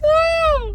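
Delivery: sharp high falsetto "Ah!"; falsetto yelp. Retold or imitated. Miming the singer's knee slide, high falsetto yelp. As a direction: squeal it like a pop star.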